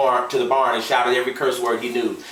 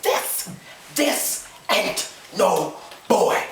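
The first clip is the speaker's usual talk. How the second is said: stern husband; 8 semitones higher, much slower than usual. A narrator's reading. Gus confronting his wife.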